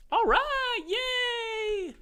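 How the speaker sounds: very high squeaky celebration; falsetto cheer. Real, not acted. Cheering after a fix.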